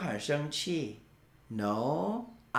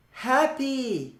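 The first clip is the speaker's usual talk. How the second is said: higher drill word; 8 semitones higher than usual. Shown as a demonstration. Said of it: "快乐".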